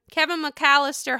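ordinary talk

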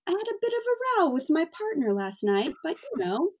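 imitating a British friend's accent, stiff upper lip; mock British accent. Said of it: "they'd be like".

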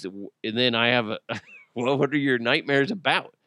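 normal talking voice

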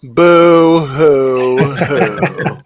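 slow, hammed-up boo hoo sobbing; mock weeping to tease; fake crying. Mocking a worried co-host.

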